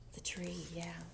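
soft whispered aside. Real, not acted. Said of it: "whispered aside to child".

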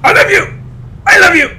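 hammed-up shout of I love you; mock yell at a dog. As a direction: bark affection at the dog, mock scolding. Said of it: "and then you yell at them".

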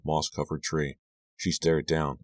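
ordinary delivery, nothing marked

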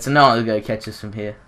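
normal talking voice

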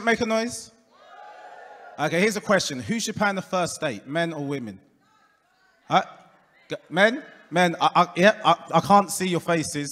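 normal talking voice

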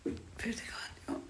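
slow soft whisper; slow hushed whisper at night. Real, not acted. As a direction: breathe it out quietly so no one wakes. Night, patient sleeping nearby.

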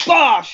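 mock punch sound. Describing a mate kicking off at the pub, mock punch sound. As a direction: slam the word like a punch. Mate's sudden pub attack.

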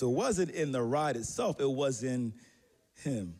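ordinary talk

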